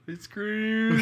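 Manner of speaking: high mocking echo of her answer.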